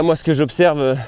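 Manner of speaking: ordinary delivery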